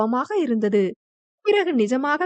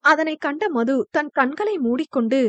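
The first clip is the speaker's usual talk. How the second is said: plain reading voice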